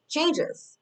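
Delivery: way up high, light and airy; high echo. Acted. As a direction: echo the word changes in a tiny high voice. Echoes her own word, playfully.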